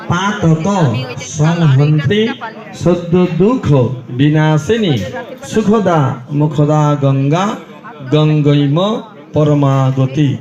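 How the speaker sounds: slow Sanskrit hymn easing into a humble plea for forgiveness; prayer to Ganga. Acted. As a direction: pray to mother Ganga, then beg pardon.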